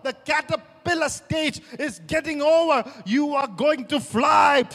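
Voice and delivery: loud, high shouted preaching; preaching at full force. Acted. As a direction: build the sermon to a roaring peak.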